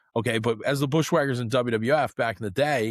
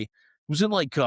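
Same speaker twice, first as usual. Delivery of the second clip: usual speaking voice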